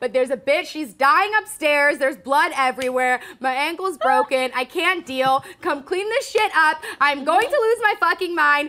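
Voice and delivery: loud, panicked ranting; frantic yell. Retold or imitated. Acting out the panicked girl.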